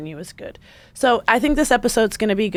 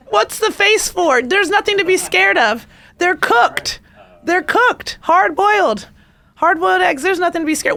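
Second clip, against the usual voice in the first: put-on much higher, louder, soothing baby-talk reassurance; mock soothing coo; 10 semitones higher, 5 dB louder than usual. Host reassuring the audience about her pocket eggs, high mock soothing coo. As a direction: coo at them like frightened pets.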